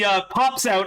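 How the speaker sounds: ordinary delivery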